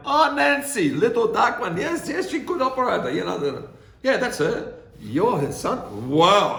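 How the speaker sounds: high, loud, delighted woman remembering; light up at the name; mock delighted factory woman, high and loud; excited recognition. Woman recalling his mother excitedly.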